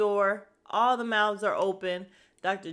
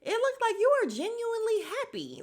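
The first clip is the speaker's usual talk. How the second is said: much higher mock-sweet voice; sugary fake sincerity; mocking the bride's claim, sugary sarcasm; sarcastic; 14 semitones higher than usual. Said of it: "And I'm like".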